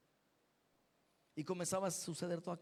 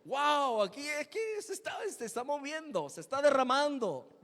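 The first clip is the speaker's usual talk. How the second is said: higher, louder amazed cry; amazed shout; 7 semitones higher, 6 dB louder than usual. Retold or imitated. Amazed at the Spirit moving, excited shout. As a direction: shout it in wonder. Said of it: "Y yo".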